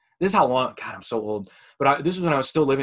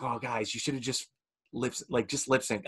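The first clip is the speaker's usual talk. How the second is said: quiet; 9 dB quieter than usual. Retold or imitated. Pitying thought, said under breath.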